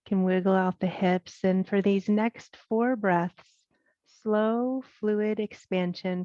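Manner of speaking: unhurried yoga breathing guidance with long pauses; guided meditation. Acted. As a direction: guide them through the breath, leaving space between phrases. Leads guided breath practice.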